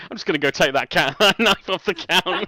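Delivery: mock a player through laughter; laughing mockery. GM teasing a player for grabbing the knife, laughing mockery.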